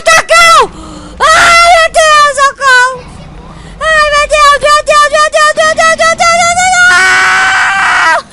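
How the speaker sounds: loud, very high frightened shrieks; panicked screaming. Real, not acted. Gamer chased by a dragon, loud high panicked shrieks.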